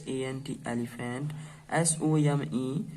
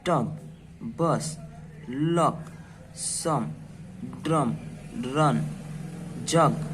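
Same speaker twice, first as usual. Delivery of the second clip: each word said slowly and clearly on its own; teacher reading a list of short u words; much slower than usual. Shown as a demonstration. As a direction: read each word out for children to repeat. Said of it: "तो चलिए इसको पढ़ के देखते हैं".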